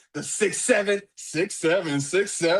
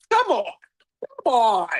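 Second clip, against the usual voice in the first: high, hammed-up tearful plea; choked up; 6 semitones higher than usual. Host protesting the ruler's missing story, choked up. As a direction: plead as if choking up, voice pitched high. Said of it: "I'm getting choked up".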